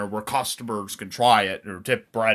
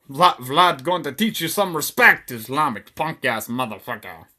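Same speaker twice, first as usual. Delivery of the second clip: raised, badly faked Romanian accent; attempted Romanian accent; 2 semitones higher than usual. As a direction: threaten as a hammy Romanian henchman. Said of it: "I can't do the Romanian".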